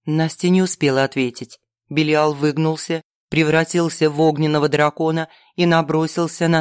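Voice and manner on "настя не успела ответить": plain reading voice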